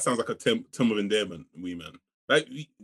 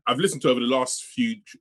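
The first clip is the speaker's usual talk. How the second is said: normal talking voice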